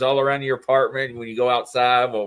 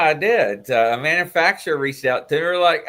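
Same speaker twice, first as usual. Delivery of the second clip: usual speaking voice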